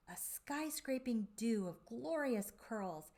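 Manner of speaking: usual speaking voice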